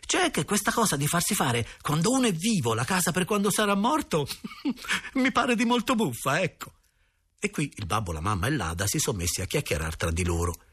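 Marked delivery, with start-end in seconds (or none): 0.1-6.7 put-on high-pitched boy talking through laughter; laughing like mad. A narrator's reading. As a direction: get the words out while giggling helplessly. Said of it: "mi son messo a ridere come un matto". (ridendo come un matto)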